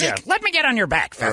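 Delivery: high squeaky character voice. Retold or imitated. Voicing movie character.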